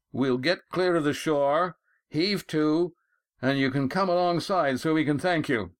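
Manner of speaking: high, carrying voice; calling across water. A narrator's reading. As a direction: call instructions over to the other boat, pitched high. Calling over to Roaring Jack's boat.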